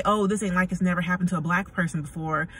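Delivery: normal talking voice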